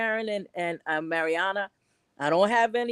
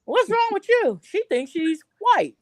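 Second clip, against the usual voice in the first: mocking neighbor; 6 semitones higher, 8 dB louder than usual. Imitating neighbors judging her, high and scornful. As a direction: sneer like a suspicious neighbor. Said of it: "they'll look at you and say".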